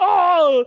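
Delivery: high, loud drawn-out mock scream; Chris Farley screaming. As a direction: scream like you're tumbling downhill. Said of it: "and he's screaming".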